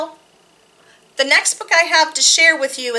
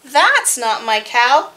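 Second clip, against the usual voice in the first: put-on reading to children; slower than usual. Reads board book to children.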